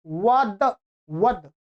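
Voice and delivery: ordinary talk, nothing marked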